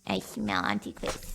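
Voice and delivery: low, slow creepy whisper. Acted. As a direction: growl it like a lurking creature. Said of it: "It was just in a creepy voice".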